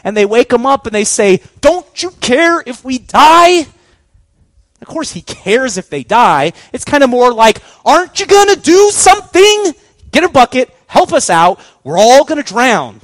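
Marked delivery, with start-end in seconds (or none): 1.6-3.7 loud, high, drawn-out desperate plea; panicked outcry. Acted. Apostles waking Jesus in the storm, loud panicked cry. 7.9-13.0 loud, high-pitched frantic appeal; panicked demand. Acted. Drowning apostles shouting at sleeping Jesus, loud frantic plea.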